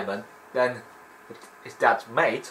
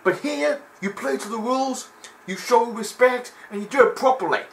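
hammed-up strict referee; stern referee warning; 12 semitones higher than usual. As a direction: scold the contender firmly. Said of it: "he was like".

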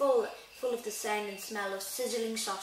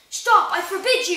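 high, loud, panicked shout; panicked yell; 9 semitones higher, 15 dB louder than usual. A narrator's reading. Uncle Vernon forbidding the secret, yelling in panic. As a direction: yell in panic to cut them off. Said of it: "yelled Uncle Vernon in panic".

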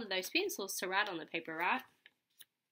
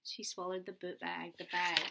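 plain reading voice

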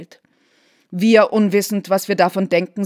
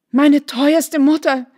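gerufen; 5 semitones higher, 4 dB louder, slower than usual. A narrator's reading. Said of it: "rief sie".

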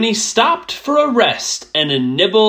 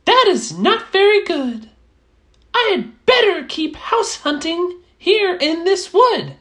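high mouse voice, mildly let down; a small mouse shrugging off a doorless tower; little mouse voice. Acted. Mouse deciding to keep hunting, high and mildly disappointed.

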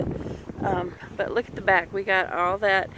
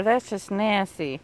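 ordinary talk

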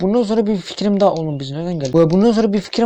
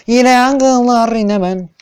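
yüksek, yavaş, ağlamaklı taklit; dramatik yakınma; 6 semitones higher, 4 dB louder, much slower than usual. Pretend. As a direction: kendine acıyan abartılı bir kahraman gibi. Oyunda yanarken dramatik yakınma. (yanarken abartılı, yavaş bir sızlanma)

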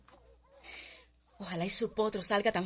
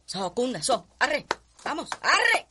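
urging horse; 5 dB louder, slower than usual. Acted. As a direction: spur the mare on, rising to a shout. (arreando a la yegua cada vez más fuerte)

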